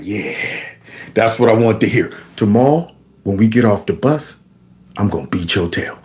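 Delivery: low-voiced bully playing it cool; menacing bully. School bully promises a beating after the bus, low acted menace. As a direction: drop low for a mock threat.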